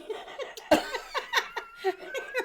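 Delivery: high-pitched burst of laughter. Real, not acted. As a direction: break into a squealing laugh. Laughing at own story.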